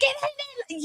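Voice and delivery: put-on high, whining kid voice; whiny kids. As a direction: mimic a kid talking back. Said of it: "They was like".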